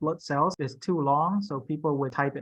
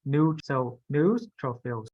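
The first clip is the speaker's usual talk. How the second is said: slow "neutrophils", NEUT isolated first; stress on first syllable; slower than usual. Shown as a demonstration. Teacher drills student, slow first-syllable stress on "neutrophils". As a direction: break off NEUT, then say "neutrophils" slowly. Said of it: "It's the first letter".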